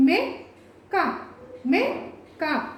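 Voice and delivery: short "మె", pause, then short "క" in "మెక్క"; short vowel. Shown as a demonstration. Teacher showing the misspelt word, short syllables said apart. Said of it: "దానికి దీనికి ఎంత తేడా ఉంది".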